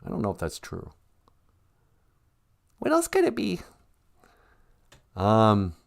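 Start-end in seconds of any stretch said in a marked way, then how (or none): 2.8-3.6 very high, small comic voice; high-voiced sidekick. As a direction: pipe up as the host's curious sidekick. Sidekick figure beside host.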